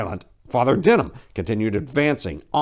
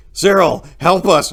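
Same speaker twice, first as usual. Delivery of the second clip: loud pleading scream; 6 semitones higher, 8 dB louder than usual. A narrator's reading. Narrator begging Cyril to save them, screaming. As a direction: scream for help at him. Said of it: "I screamed at him".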